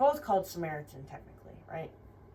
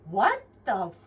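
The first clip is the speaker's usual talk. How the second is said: louder, indignant, breaking off mid-swear; cut-off angry outburst; 9 dB louder than usual. Retold or imitated. Finding the dog locked inside, a louder cut-off curse. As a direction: bite it off before the word lands. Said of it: "And I was like".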